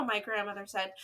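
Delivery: plain reading voice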